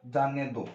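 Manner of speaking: जाने दो dropped low and soft after the break; phrase after a pause. Shown as a demonstration. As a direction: let जाने दो fall away as its own phrase. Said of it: "रुक गया".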